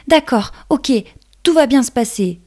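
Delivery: high, self-encouraging ladybug; little ladybug finding courage. Acted. Ladybug steels herself.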